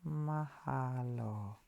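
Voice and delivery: slow, quiet, drawn-out Mahalo syllable by syllable; teaching a word. Shown as a demonstration. Teacher slowly sounds out Mahalo for viewers, soft and drawn-out. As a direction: spell out Mahalo softly and slowly for learners. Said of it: "Let me write it so you see it".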